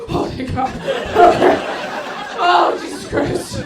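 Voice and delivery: high, breathless hammed-up panic; mock panicked waking. Audience member waking from a nightmare, hammed-up panic. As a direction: gasp awake like it was real.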